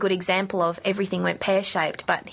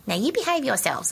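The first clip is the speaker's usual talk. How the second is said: mock bossy adult; 6 semitones higher than usual. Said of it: "not just being a bossy adult saying".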